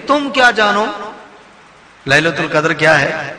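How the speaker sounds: pointed "what would you know"; challenging reading. Shown as a demonstration. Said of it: "ایک انداز یہ ہے".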